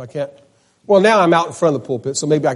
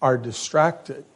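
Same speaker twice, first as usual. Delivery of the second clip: quiet tender rebuke; 2 semitones lower, 9 dB quieter than usual. Retold or imitated. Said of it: "he says".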